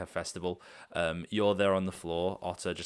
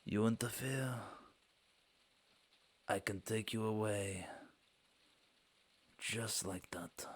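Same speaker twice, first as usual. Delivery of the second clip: menacing threat; 3 semitones higher, 6 dB quieter, slower than usual. Acted. Halfling warning the player not to interfere, soft and menacing. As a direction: threaten them quietly, as if it's nothing. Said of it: "kinda looks towards you".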